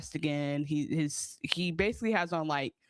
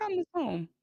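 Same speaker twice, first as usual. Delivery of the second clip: mock whiny; 11 semitones higher than usual. Harper voiced reacting to mess.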